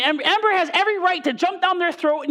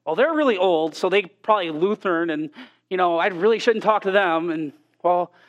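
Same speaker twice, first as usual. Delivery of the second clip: low, mumbled rationalizing; inner excuses; 7 semitones lower than usual. Retold or imitated. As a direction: talk yourself out of it under your breath, lower. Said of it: "I'll look at these people like".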